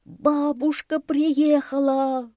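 high, loud, drawn-out cheer; joyful shout. A narrator's reading. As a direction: shout the happy news, stretching it. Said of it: "кричал удав". (радостный крик, высоко и протяжно)

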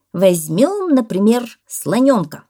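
loud, emphatic proposal; parrot exclaiming. A narrator's reading. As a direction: declare it word by word. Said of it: "воскликнул попугай". (громко, с воодушевлением)

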